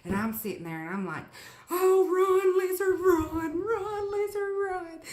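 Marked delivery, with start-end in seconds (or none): 1.7-5.0 high, loud sing-song cheer; excited cheering. Retold or imitated. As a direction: cheer it on like it can hear you. Cheering lizard on TV.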